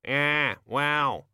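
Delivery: high croaky raven voice; raven mutter. A narrator's reading. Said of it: "muttered a voice".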